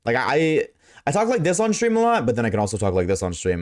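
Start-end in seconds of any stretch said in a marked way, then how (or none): 1.1-2.2 the bright streamer voice, pitched way up; high performed stream voice. Shown as a demonstration. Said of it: "this is how I sound without any adjustments". 2.2-3.6 the unadjusted low voice, faster; dropped to his real lower register; natural lower voice. Shown as a demonstration. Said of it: "this is how I sound without any adjustments".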